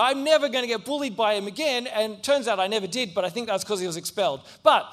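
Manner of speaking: ordinary talk, nothing marked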